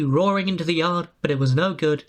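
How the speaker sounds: ordinary delivery, nothing marked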